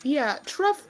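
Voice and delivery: usual speaking voice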